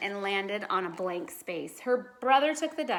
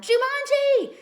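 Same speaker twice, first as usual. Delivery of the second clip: high, loud full-voice yell of the word; Judy slams her piece and yells the game's name; 10 dB louder, slower than usual. A narrator's reading. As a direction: yell it as loud as you can. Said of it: "she yelled as loud as she could".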